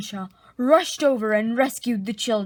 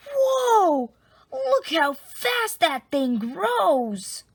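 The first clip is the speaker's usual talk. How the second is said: amazed; 5 semitones higher, slower than usual. Acted. Kids marvel at the growing chick.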